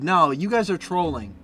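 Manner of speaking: ordinary talk, nothing marked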